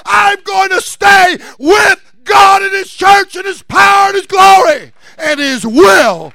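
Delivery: loud, high preaching shout, building; full-force preaching. Real, not acted. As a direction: build to a driving sermon climax.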